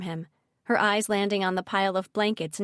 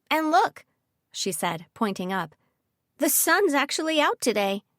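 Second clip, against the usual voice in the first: higher, louder delighted voice; excited; 6 semitones higher, 3 dB louder than usual. Acted. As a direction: point it out with delight. Mira excited by sunshine.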